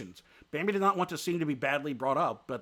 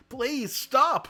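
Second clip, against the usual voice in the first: high, louder plea for mercy; pitiful begging; 11 semitones higher, 7 dB louder than usual. A narrator's reading. Beaten deer begging his attacker to stop, pitifully. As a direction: beg him to stop, pitifully. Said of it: "begged him pitifully".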